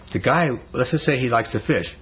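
ordinary delivery

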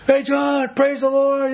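high, loud mock shout of praise; imitated yell across the store. Retelling an eager friend calling to John across the store, loud and high. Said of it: "he'd yell across the store".

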